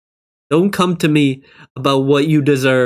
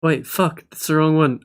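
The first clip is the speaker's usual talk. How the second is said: usual speaking voice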